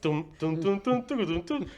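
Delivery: soft sung phone ring, rising in the middle; quiet mock Nokia ring; imitated ringtone. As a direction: hum an old phone ringing. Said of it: "I hear his phone ring".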